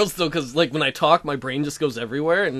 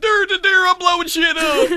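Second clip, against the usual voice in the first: loud high gleeful shout; 9 dB louder than usual. Retold or imitated. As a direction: yell it like a reckless gamer. Mocking own careless play.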